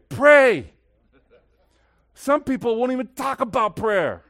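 high, loud pulpit exclamation; impassioned preaching. Real, not acted. Preaches at full force.

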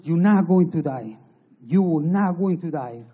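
preacher insisting to the sick man he will live; emphatic assurance. Retold or imitated. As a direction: declare it with total conviction. Visitor assuring the dying prisoner.

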